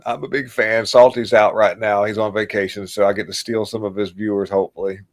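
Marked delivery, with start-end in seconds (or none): none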